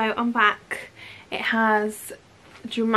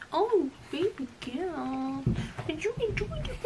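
dog voice; 5 semitones higher, 9 dB quieter than usual. Real, not acted. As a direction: coo at the puppy while she eats. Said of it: "Excuse my dog voice as well".

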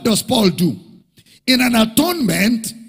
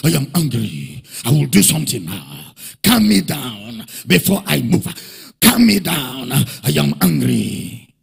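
a hint of a shouting God, much lower; preacher voicing a demanding angry God, lower; 7 semitones lower than usual. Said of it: "God is just standing and shouting".

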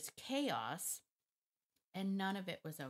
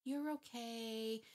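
softened soothing tone; 4 semitones higher, much slower than usual. Shown as a demonstration. Showing viewers how owners soften their voice, slow and cooing. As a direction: soothe a startled dog, drawn out and gentle. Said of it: "See how I said it that way?".

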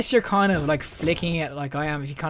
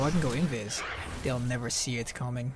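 sneaky hush; 6 semitones lower, 10 dB quieter than usual. Pretend. Turns invisible to sneak up.

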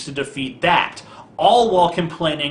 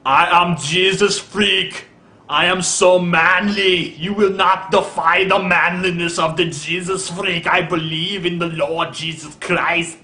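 slow, louder, puffed-up macho voice; mocking Jesus Freak impression; 3 semitones higher, 3 dB louder, slower than usual. Impersonating the Jesus Freak to ridicule him, slow and pompous. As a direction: play him as a pompous, self-important manly man. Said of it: "this is my impression of you, Jesus freak".

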